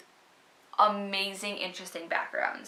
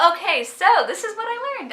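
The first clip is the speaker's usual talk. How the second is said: much higher, louder confident start; confident and bright; 12 semitones higher, 8 dB louder than usual. Retold or imitated. Her new self facing the camera, higher louder confident start. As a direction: launch in with energy.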